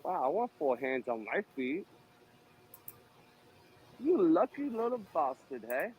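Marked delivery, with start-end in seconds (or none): 4.0-5.9 mock envious. Envying the massaged guy.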